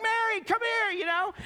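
very high, loud call to his wife; excited dad calling. Retold or imitated. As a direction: shout for her to come see. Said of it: "He's like".

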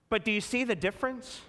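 ordinary delivery

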